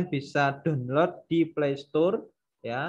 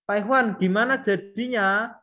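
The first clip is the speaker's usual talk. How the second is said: eager trainee asking; 7 semitones higher, 5 dB louder than usual. Acted. Voices a trainee asking again.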